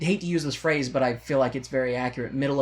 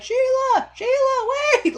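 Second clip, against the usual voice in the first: very high, loud mock yell of her name; calling after the car; 5 dB louder than usual. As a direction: shout after a car driving off, hammed up. Berger chasing the departing car.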